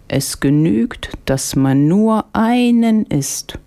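high, slow market woman; old market woman's slow, high sales pitch; vegetable seller. A narrator's reading. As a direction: draw it out high, praising precious wares. Market woman explaining apples' power.

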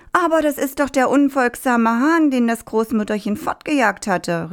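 high, exclaimed announcement; calling out. A narrator's reading. Farmer tells the people about the rooster, calling out. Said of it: "rief der Bauer den Leuten zu".

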